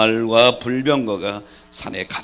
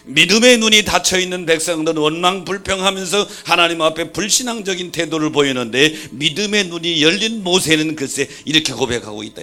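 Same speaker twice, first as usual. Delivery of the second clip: loud preacher cadence; preaching; 7 semitones higher, 10 dB louder than usual. Real, not acted. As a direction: press the contrast hard. Preaching at full force.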